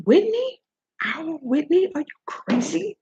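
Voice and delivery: high rising disbelieving questions; incredulous. Real, not acted. Challenging the host over our Whitney, incredulous.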